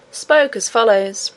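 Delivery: usual speaking voice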